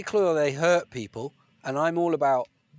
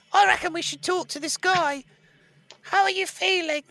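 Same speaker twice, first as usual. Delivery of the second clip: higher, kindly character voice; 14 semitones higher than usual. Acted. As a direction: play the concerned companion. Plays character.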